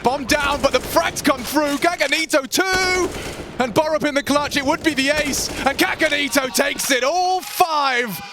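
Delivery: high, hyped call building to the ace; excited play-by-play. Real, not acted. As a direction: ride the hype up to the ace. Ace called live, rising hype.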